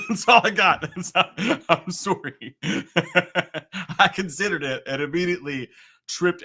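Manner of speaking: real laughter breaking through, high; laughing apology. Real, not acted. Streamer apologizing for a failed impression, laughing through it. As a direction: laugh helplessly while owning up.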